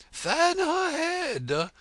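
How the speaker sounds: high, loud, slow urgent queen; anxious. A narrator's reading. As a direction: call out in alarm to fan her. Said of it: "the Red Queen anxiously interrupted".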